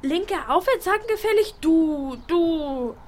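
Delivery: high, loud playful threat; mock threat. Girl to her nagging friend, high mock-threatening. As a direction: threaten her teasingly with a punch.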